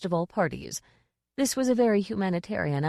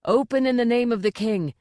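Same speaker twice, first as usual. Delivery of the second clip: loud cry of a royal guard; guard calling out at a cottage door, loud; shouted order; 5 semitones higher, 4 dB louder than usual. Acted. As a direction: bellow it at the door. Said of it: "a voice cried".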